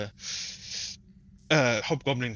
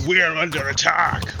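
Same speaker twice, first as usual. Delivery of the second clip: raised, higher goblin alarm cry; screamed alarm; 4 semitones higher, 3 dB louder than usual. Acted. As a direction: sound the alarm, louder and higher. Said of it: "screaming in a, uh, in a goblin word".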